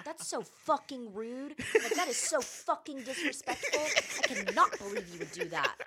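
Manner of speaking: high, loud, laughing tirade; retold screaming. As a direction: scold them loudly, half laughing. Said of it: "I was screaming".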